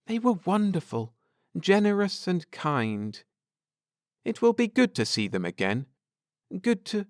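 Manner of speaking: fond memory, quickly said; lightly voiced Ned. A narrator's reading. Husband remembering the Sweeneys, lightly voiced.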